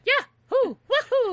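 squeaky high falsetto whoops; Old Man Fu's cry. Retold or imitated. Old man whooping while bunny hopping backwards. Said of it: "he went".

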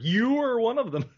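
"You" swooped high and loud, over-enunciated; open-mouthed "you". Retold or imitated. Quoting Rickman's first sentence, exaggerated high "You". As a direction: hit "You" with a big open-mouthed vowel. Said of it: "it's a very, uh, open-mouthed".

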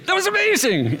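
high thrilled "amazing"; awed exclamation again. Retold or imitated. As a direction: gush with wonder. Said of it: "look at each other and go".

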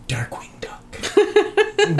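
slow, high breathy whisper. Pretend. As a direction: breathe the name in awe. Reverent whisper of name.

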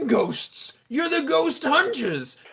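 high, drawn-out theatrical outrage; exasperated rich host. Acted. Owner scolds the ghost hunters, exasperated. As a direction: throw it back in a put-on, incredulous voice.

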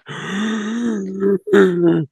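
long theatrical mock gasp. Imitating the canned audience, a hammed-up gasp. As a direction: gasp like a shocked sitcom audience.

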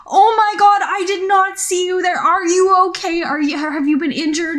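high, loud, panicked apology; a driver who thinks she just hit someone; distressed. Acted. Lawyer rushing to the pedestrian she hit, distressed. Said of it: "looks very distressed".

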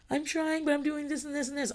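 put-on high, whiny child voice; struggling kid. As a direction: plead like a kid who is stuck.

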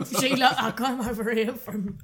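loud, laughing mock Cockney accent; put-on Cockney accent. Friends tease the accent, a mock Cockney accent. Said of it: "that accent".

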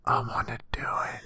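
unvoiced, secretive whisper. Real, not acted. Confessing shipping wish.

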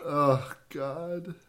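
breathless after laughing hard; winded, sighing between words; out of breath. Real, not acted. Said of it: "Sorry, I need to catch my breath".

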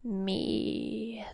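soft, crackly demon croak; crackling creature. A narrator's reading. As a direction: crackle it back very quietly. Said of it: "the thing crackled back at her".

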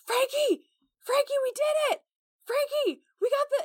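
high, excited calling across the room; excited shouting. Acted. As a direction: yell his name, thrilled you won. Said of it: "He's like".

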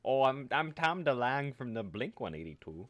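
a light put-on accent, mocking; hammed-up [name] voice; imitating [name]. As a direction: play him like a dopey rock star. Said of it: "He does not sound like that".

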